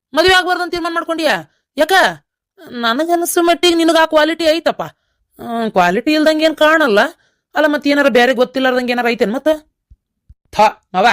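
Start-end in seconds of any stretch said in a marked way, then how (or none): 0.1-4.8 high, astonished question; can't believe what he just heard; shocked disbelief. Acted. Uncle shocked son refuses marriage. (ಆಶ್ಚರ್ಯದಿಂದ ಎತ್ತರದ ಧ್ವನಿಯಲ್ಲಿ ಕೇಳುವುದು)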